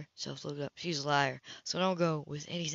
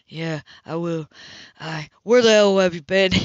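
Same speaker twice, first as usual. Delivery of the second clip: angry outburst; 11 dB louder than usual. Acted. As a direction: hold it, then explode with the question. Cam bursts out.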